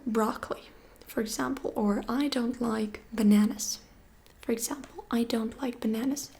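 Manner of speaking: ordinary talk, nothing marked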